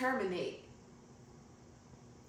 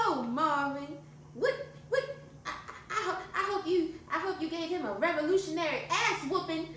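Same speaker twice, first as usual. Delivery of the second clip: higher, hammed-up old country lady fighting laughter; playing Harriet; 7 semitones higher than usual. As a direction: play a fired-up old aunt. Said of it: "Just make yourself a character".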